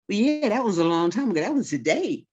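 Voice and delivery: put-on a child brushing off grandma's point; kid protesting. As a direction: argue back like a cheeky child.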